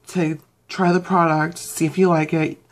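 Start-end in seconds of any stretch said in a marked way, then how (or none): none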